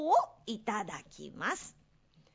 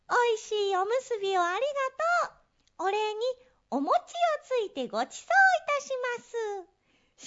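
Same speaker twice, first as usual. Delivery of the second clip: mouse voice; 12 semitones higher, faster than usual. Acted. Mice greet grandpa.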